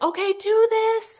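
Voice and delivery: high, carrying call upward; yelling up. Retold or imitated. Photographer calling poses up the stairwell, high, carrying call. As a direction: direct someone several floors up. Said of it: "And I was just yelling up to her".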